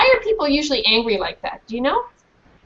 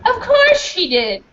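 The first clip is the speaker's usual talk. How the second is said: much higher, curt and scornful; haughty high voice; 11 semitones higher, 4 dB louder, slower than usual. Acted. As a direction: answer with contempt. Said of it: "Dorothea Crutch replied".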